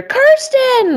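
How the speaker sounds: high, loud call across a hall; called out name. Retold or imitated. Recruiter calling the speaker across the show floor, high shout. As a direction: shout her name from far away.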